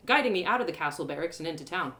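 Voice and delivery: ordinary delivery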